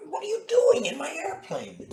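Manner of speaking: very high, loud mock protest; hammed-up high-pitched alarm; alarmed pilot. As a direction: squeal it like a flustered pilot. Pilot confronts gremlin in his plane.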